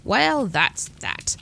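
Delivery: very slow, sighed out; sighing. A narrator's reading. Lily sighs after the phone call, slowly. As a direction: let it out on a tired sigh. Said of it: "Lily sighed".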